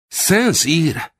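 high, amazed echo of the name; astonished cry. A narrator's reading. Narrator recognising a famous hunter's name, high amazed cry. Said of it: "kiáltottam fel álmélkodva".

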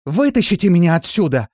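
high, loud cry for help; yelled plea. A narrator's reading. Trapped woman begging to be pulled out, loud cry. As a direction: yell up to be rescued. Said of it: "закричала Биота".

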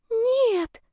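very high, small voice; timid girl. A narrator's reading. Lovesick girl denies her beloved is a womanizer, timid and high. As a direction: object in a tiny high voice.

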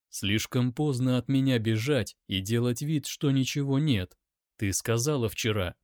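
ordinary delivery, nothing marked

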